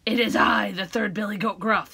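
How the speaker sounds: loud, big goat voice. Acted. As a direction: answer as loud as the troll. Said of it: "his voice was as loud as the troll".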